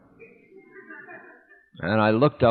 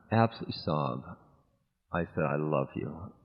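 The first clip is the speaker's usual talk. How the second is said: much softer, low and tearful; near tears; 8 semitones lower, 11 dB quieter than usual. Real, not acted. Disciple confessing love to guru, tearful and soft. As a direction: let the voice break, barely there. Breaking down retelling the moment.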